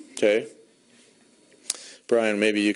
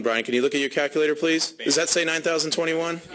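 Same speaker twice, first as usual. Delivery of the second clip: normal talking voice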